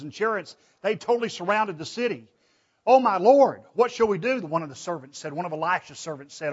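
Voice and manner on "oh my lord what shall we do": higher, louder alarmed cry; a servant crying out to his master in fear; panicked servant. Acted. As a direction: voice up high with fright. Servant panics, army surrounds city.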